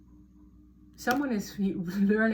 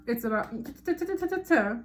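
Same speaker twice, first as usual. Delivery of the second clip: rapid ta-ta chatter; faster than usual. Shown as a demonstration. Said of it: "mimicking rapid-fire chatter".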